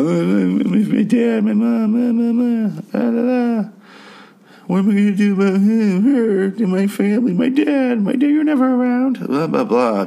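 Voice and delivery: very high-pitched, whiny babble; mock whining. Reviewer mocking everybody else's family bullshit, high whiny caricature. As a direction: squeal their family complaints as hammed-up mockery. Mocks everyone's emotional bickering.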